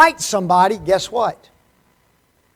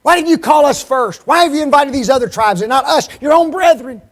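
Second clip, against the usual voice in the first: loud, high, indignant demand from the tribe; the men of Ephraim confronting Gideon, loud and aggrieved; angry challenge; 7 semitones higher, 7 dB louder than usual. Acted. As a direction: hurl the questions as an offended kinsman. Angry Ephraimites voiced in sermon.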